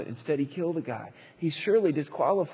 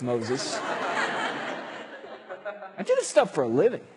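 amused God; 5 semitones higher, much slower than usual. Acted. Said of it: "God says".

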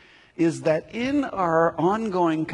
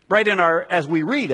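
ordinary delivery

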